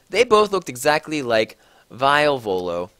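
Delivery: plain reading voice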